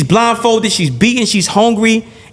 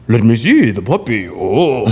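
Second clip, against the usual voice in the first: lower, sinister monster voice; mock menacing Dracula; 6 semitones lower than usual. Dracula luring the owner to see the puppy, low sinister mock voice. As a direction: drop low like a lurking predator.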